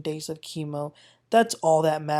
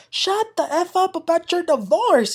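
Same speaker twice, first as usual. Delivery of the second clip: higher, mock fed-up snap; pretend angry outburst; 10 semitones higher than usual. Recapper pretending to yell at Lisa, mock anger. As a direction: say what you wish you'd yelled. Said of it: "I was like".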